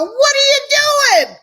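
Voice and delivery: high, shrill-free alarmed what are you doing; exasperated boss. Retold or imitated. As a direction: squeal it like a startled manager. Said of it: "call me in five minutes and go".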